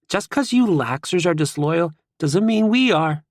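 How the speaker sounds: lightly voiced cocky teammate; teasing jab. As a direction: needle the rivals while pinned down. Teammate teasing while pinned in snow.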